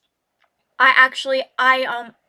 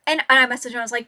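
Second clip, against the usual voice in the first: usual speaking voice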